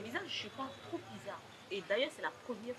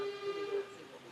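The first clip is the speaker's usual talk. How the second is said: usual speaking voice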